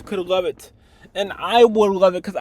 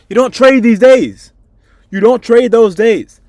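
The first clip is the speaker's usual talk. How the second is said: furious self-scolding shout; 5 semitones higher, 9 dB louder than usual. Real, not acted. Trader yelling at himself after losing money, furious shout. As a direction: shout it louder and higher, furious at yourself.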